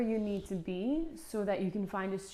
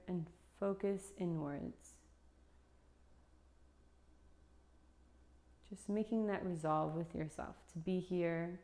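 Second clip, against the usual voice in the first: guided meditation. Acted. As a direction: lead them gently into stillness, unhurried.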